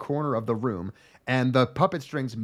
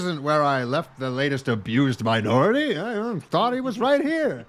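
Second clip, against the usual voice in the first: put-on dad voice. Dad walking into the garden, lightly voiced and pitched up. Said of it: "that's what he sounds like".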